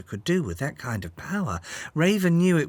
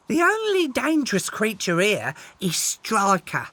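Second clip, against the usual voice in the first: lightly voiced bird; 6 semitones higher, 3 dB louder than usual. Misty the bird speaks, amused.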